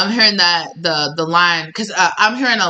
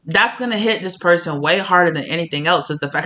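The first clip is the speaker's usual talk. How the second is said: ordinary talk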